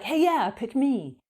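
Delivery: eager pick me. Retold or imitated. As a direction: play the eager neighbour putting a hand up. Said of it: "And the people are like".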